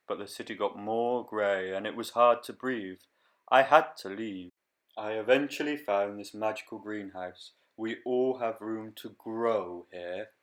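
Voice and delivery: lively storybook reading voice; reading to children. Acted. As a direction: read it like a picture book. Reads picture book aloud.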